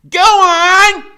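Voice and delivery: high, loud order; commanding Freak. A narrator's reading. Freak pushing the dictionary on him, high loud order. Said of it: "he says".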